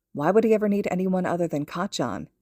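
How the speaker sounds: plain reading voice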